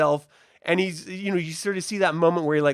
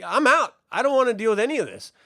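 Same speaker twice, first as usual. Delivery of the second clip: higher, louder, fast brush-off; dismissive dragon; 8 semitones higher, 5 dB louder than usual. Retold or imitated. The dragon Sea Smoke refusing everyone, higher and quick. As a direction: blow them off and hurry away. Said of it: "He's like".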